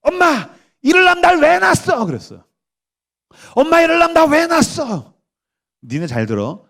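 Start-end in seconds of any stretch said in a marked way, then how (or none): 0.0-2.1 high, loud accusing cry; daughter's angry outburst. Acted. Daughter attacks mother with her secret weapon, furious. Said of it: "딸이 이제 비장의 무기를 내세웠어". 3.6-5.1 very high-pitched accusing yell; acted blow-up at mom; daughter's angry outburst. Daughter attacks mother over being born, furious. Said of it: "딸이 이제 비장의 무기를 내세웠어".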